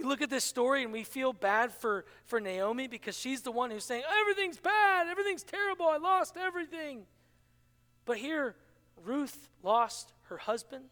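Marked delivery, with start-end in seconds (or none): 4.2-7.1 a whining, higher lament; mock despair. Naomi moaning to Ruth, high mock despair. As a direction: wail it like the grieving mother-in-law.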